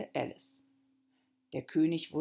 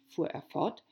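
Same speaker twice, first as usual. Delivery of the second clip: plain reading voice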